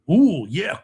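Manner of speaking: ordinary talk, nothing marked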